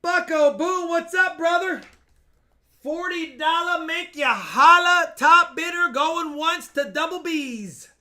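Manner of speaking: loud hyped auction call; auctioneer. Real, not acted. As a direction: pump up the bidders.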